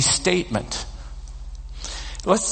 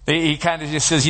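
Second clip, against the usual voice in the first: normal talking voice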